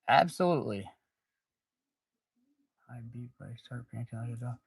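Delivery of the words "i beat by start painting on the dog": heavy breathing into the mic; mouth breathing. Real, not acted. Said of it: "I breathe hard".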